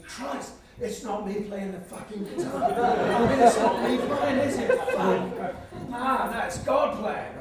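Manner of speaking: high, loud amazement; awestruck realisation. Retold or imitated. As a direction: retell a stunned thought, building to loud wonder. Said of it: "I'm thinking".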